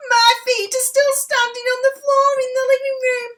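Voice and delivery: very high, loud witchy voice; cackling old woman. A narrator's reading. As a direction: squeal it like a gleeful old crone. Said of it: "cackled the old woman".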